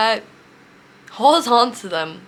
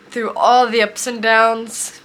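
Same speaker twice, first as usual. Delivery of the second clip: ordinary talk, nothing marked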